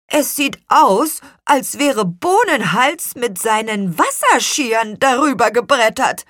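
high, loud little apple man; animated high-pitched story figure; character voice. A narrator's reading. Apple man voiced accusing his friend.

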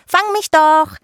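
high, loud sing-out; gleeful little fairy daring him; playful taunt. Acted. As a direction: call down teasingly to be chased. Giggling fairy taunts from the tree.

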